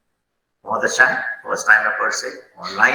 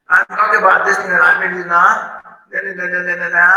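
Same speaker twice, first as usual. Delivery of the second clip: "ना" tacked on high at the end; faulty filler intonation demo; 6 semitones higher, 3 dB louder, slower than usual. Shown as a demonstration. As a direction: mimic a high, repeated filler "ना". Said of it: "ये intonation होता है".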